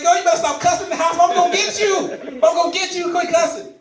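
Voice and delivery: high, loud, fast mock scolding; scolding sister. Brother scolding his sister for cussing, loud and fast. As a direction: bark it like a strict brother. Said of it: "I'm like".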